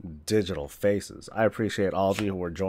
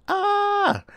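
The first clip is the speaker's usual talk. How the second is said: sudden high-pitched squeal; high yelp; 4 dB louder than usual. Real, not acted. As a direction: let out a short excited yelp. Sudden exclamation about birthday.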